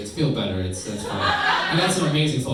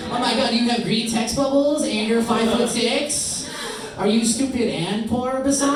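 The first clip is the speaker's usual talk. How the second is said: put-on much higher, slow valley-girl scorn; mocking girl voice; 12 semitones higher, 4 dB louder, slower than usual. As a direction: sneer like a snobby girl, high. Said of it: "every girl here".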